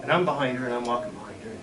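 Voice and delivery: plain reading voice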